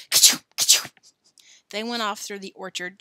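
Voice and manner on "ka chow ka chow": loud, explosive mock sneeze; acted sneeze. Joe imagining Billy sneezing on pepper, explosive mock sneeze. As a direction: burst out with a fake ka-chow. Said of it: "Joe sneezing".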